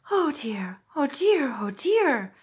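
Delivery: worried little piglet, half to himself; fretting under his breath; fretful oh dear. A narrator's reading. Piglet frets to himself near the trap, worried. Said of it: "said Piglet to himself".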